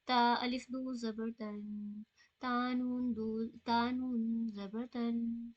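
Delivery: تا الف دو زبر تن read slow and settled, نون smooth without a jolt; right way, calm unhurried تن. Shown as a demonstration. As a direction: ease through تا نون زبر تن slowly. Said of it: "آرام سے پڑھ کے، رک کے".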